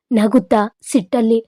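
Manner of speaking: ordinary delivery, nothing marked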